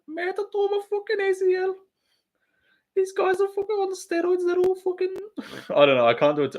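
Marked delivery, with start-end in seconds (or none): 0.1-5.2 extremely high squeaky mimicry; Darren Till. Streamer attempting a fighter impression, strained sky-high voice. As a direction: push the voice way up into falsetto. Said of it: "good Till impression".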